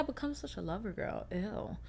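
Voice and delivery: hushed, embarrassed whisper. Real, not acted. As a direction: drop to a secretive whisper. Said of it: "unvoiced whisper".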